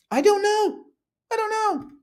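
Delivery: very high, squeaky helplessness; mock-helpless squeal, way up high; exaggerated cluelessness. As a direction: plead ignorance in a tiny high voice. Exaggerated cluelessness about who regulates.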